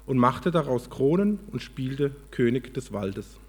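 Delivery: lively, measured storybook reading; expressive storyteller with lifted key words; story narration. A narrator's reading. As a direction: read it aloud like a parable, with pauses. Reads a story aloud.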